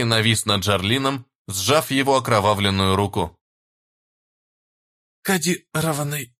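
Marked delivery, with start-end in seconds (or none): 5.2-6.3 dying wheeze, rasped out; hoarse, coughing blood. A narrator's reading. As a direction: rasp it while choking on blood. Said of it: "кашляя кровью, прохрипел тот".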